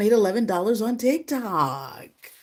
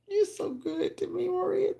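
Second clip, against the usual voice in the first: tearful thanks; 12 semitones higher, 6 dB quieter than usual. Real, not acted.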